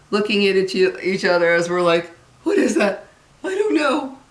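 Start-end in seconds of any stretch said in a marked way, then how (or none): 2.4-4.2 high, rising astonishment; imitated gasping disbelief, climbing higher; mock freaked out. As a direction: act out two alarmed kids reacting. Acting out freaked-out siblings.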